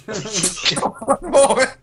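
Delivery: high, drawn-out words through laughter; laughing. Acted. Player repeating the character's excuse to the enemies, laughing. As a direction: stretch it out while cracking up. Repeats the line while cracking up.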